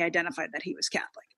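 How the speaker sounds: hushed aside, fast; a quick whispered aside. Real, not acted. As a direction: whisper it like a secret. Aside while recounting edited scene.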